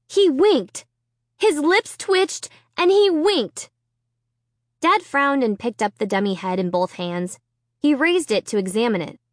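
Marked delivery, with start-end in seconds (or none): 0.1-3.7 high, urgent insistence; high and slow on the twitch; insistent. Acted. Girl insists to father.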